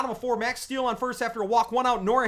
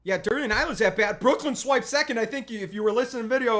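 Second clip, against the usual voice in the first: ordinary talk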